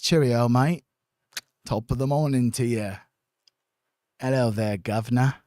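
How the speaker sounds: mock cheery English greeting in an English accent. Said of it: "Isn't that, like, an English accent?".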